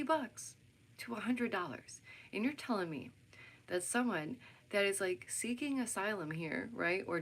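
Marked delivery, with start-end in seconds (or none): none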